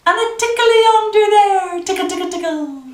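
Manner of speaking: high squealy playful tickling voice; playful tickle. Acted. Parent tickling a baby, high playful voice. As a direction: rise up into the tickle.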